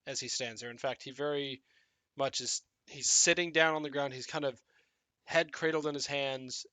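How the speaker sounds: ordinary delivery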